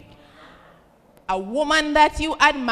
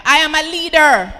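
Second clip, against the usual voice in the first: said with conviction, louder; louder, convinced; 3 semitones higher, 7 dB louder than usual. Shown as a demonstration. Pushed to say it again, louder and convinced. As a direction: belt it out like you believe it. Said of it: "Another, another time".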